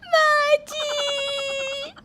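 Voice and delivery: high, very drawn-out crying call; sobbing cry to mother-in-law; sobbing wail. Acted. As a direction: wail the word through tears. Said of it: "Surabhi रो रही है".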